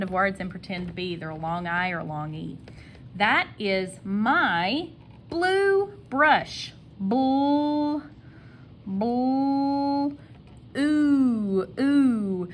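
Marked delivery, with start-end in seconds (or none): 3.2-6.7 high, very slow "That is my blue brush", word by word; slow dictation. Shown as a demonstration. Teacher models the sentence slowly. 7.0-12.5 high, long-held "Bull" and "ooh"; sounded-out blend. Shown as a demonstration. Teacher sounding out "blue.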